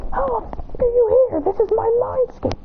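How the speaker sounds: high-pitched indignant outburst; shocked protest. Acted. Akane walks into his mindscape, shocked high protest. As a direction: pitch it way up in disbelief.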